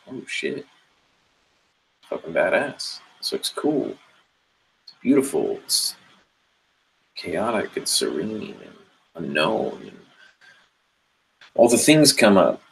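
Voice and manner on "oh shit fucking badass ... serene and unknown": awed hush. Retold or imitated. Awed at seeing the jungle.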